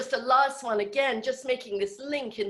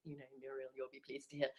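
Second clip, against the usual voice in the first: usual speaking voice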